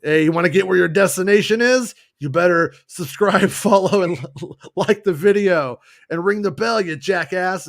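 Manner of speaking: bully the passenger into subscribing; pushy driver shilling. Retold or imitated. Driver pushing passenger to subscribe, loud mock bossy. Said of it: "Max is out there shilling".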